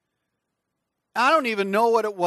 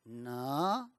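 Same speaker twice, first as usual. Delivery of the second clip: put-on child denying; 8 semitones lower, 12 dB quieter than usual. Child denies to parent.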